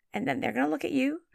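ordinary talk, nothing marked

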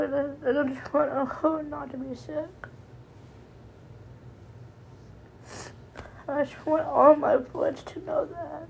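real tears, voice cracking with love and worry; voice breaking in tears. Real, not acted. Daughter telling friends she loves her sick mother, crying. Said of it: "my voice is breaking 'cause I'm in tears".

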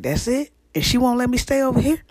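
put-on high, loud outraged disbelief as a kid; indignant disbelief. Kid asking about grandma's rule, shocked and loud. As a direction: cry it out like a shocked child who can't believe it. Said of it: "And I was like".

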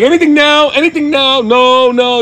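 high-pitched, stretched-out calling; yelled check. Retold or imitated. Checking with family downstairs for a leak, yelled. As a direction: holler so it carries to another floor. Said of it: "I'm having some yelling downstairs".

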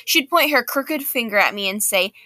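usual speaking voice

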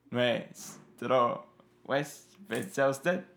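put-on slow French-accented Spanish; Lumière's French accent. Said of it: "nótese el acento francés".

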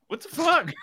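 very high-pitched yelp, half laughing; mock outraged surprise. Retelling a character getting bumped, high laughing yelp. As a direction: squeal it in imitated shock.

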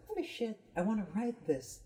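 high, thrilled burst of inspiration; excited. Retold or imitated. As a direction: blurt it out, suddenly inspired. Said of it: "I was like".

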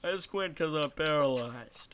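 slow, loud cartoon impression; Joe Swanson. Clowning for a podcast co-host, Joe Swanson impression. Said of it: "It's my Joe Swanson voice impression".

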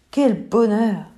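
plain reading voice

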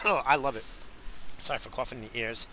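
ordinary talk, nothing marked

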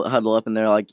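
usual speaking voice

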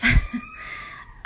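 short high nervous giggle; a quick embarrassed laugh. Real, not acted. Nervous laugh before confiding.

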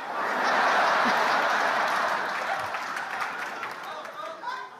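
long breathless laugh climbing up; airy rising laugh. Real, not acted. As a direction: let the laugh run out of breath.

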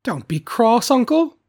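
high, loud, rising appeal; placating nephew. A narrator's reading. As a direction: coax your cross uncle in a lifted voice. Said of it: "said the nephew".

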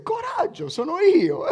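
Jesus calling to his frightened disciples, laughing; cheerful Jesus. Acted. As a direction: play Jesus calling out cheerfully over the sea. Preacher voices Jesus, laughing.

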